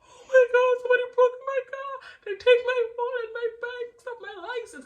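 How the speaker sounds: very high, loud acted wail; panicked high-pitched wail, acted out; hysterical crying reenactment. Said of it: "literally like this".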